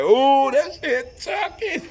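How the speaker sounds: high, slow, laughing delight; delighted over the ring. Retold or imitated. Admiring the wedding ring on his finger, high laughing delight.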